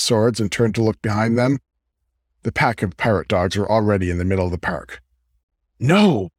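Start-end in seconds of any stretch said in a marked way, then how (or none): 5.8-6.3 sharp, higher-pitched barked refusal. A narrator's reading. Oakley turns down King's offer of hints, barked. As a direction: bark it out in one quick burst. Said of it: "Oakley barked".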